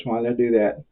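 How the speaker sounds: ordinary talk, nothing marked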